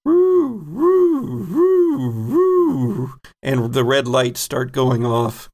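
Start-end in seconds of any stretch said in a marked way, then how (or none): 0.0-3.1 high hooting siren woo; klaxon imitation. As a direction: mimic a blaring alarm siren. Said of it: "you hear klaxons".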